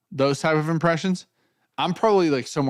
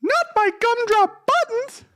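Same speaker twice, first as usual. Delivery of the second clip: very high slow squeaky voice; Cam impression; 4 dB louder, slower than usual. Impersonating Cam with a squeaky line. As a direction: squeak it like a tiny gingerbread man. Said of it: "That's Cam".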